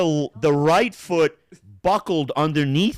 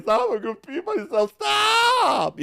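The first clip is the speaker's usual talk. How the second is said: high, rising to a shouted stop; laughing plea; 4 dB louder, slower than usual. Retold or imitated. Daughter begs him to stop replaying scenes, high, rising shout. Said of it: "she's like".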